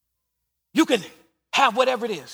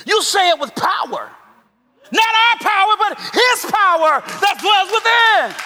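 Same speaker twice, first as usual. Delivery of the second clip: loud, high, powerful declaration; preacher shouting with authority; 8 semitones higher, 6 dB louder than usual. Shown as a demonstration. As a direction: proclaim it with full force. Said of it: "Say it with authority".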